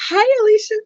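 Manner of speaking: high squealed hey; high greeting. Real, not acted. Streamer greeting Alicia, squealed. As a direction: pitch the hello way up.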